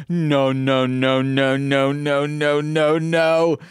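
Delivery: mock-horrified run of no's on one held note; retold horrified screaming. Viewer reacting to unexpected body horror, retold mock screaming. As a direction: imitate your own horror as one unbroken string. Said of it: "screaming because I was not expecting body horror".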